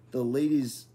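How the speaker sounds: plain reading voice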